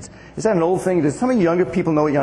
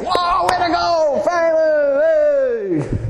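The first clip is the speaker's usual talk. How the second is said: cheering praise; 11 semitones higher, much slower than usual. Retold or imitated. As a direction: cheer them on like a delighted pastor. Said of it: "Don't you hear, like".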